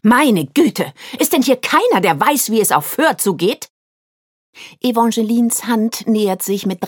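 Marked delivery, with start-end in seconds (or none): 0.0-3.7 barking. Acted. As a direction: bark it out in exasperation. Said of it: "kippt um in ein Blaffen".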